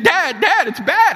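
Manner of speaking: very high, loud panicked cries; frantic. Retold or imitated. Son phoning father about a bad accident, frantic. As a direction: shout the alarm, beyond calming down. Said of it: "Stephen called me frantic".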